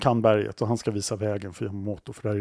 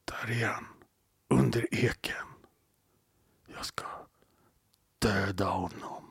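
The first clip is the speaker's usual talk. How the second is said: menacing whisper; 7 dB quieter than usual. Acted. Hunter tells guide he'll kill the bear, menacing whisper. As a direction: whisper it to your companion, drawing out the threat.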